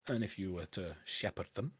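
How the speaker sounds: canny Scottish dwarf dealer. Acted. Dwarf pitches smuggling bottles to a collector, canny Scottish dwarf. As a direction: sell the scheme in a Scots dwarf voice. Game master voices dwarf.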